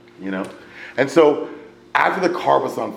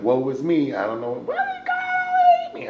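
mock self-pity; 14 semitones higher than usual. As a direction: whine like a hammed-up victim. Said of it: "'Cause normally I'd have been like".

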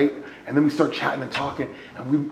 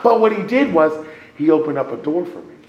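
ordinary delivery

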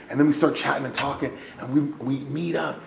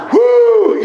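exasperated whoop; 15 dB louder than usual. Real, not acted. Exasperated outburst.

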